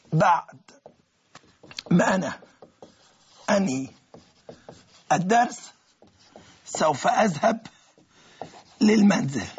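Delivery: slow word-by-word dictation of the translation; dictation pace. Shown as a demonstration. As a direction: spell it out for note-taking.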